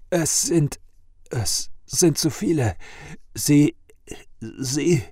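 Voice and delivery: high, halting stammer of the wounded. A narrator's reading. Badly wounded farmer bursting into the tavern to warn them, stammering. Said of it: "stammelte er".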